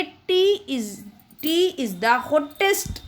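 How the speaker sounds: each word spaced out slowly for the class; slow dictation. Shown as a demonstration. Teacher to her students, slow dictation. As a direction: dictate it slowly so children can write.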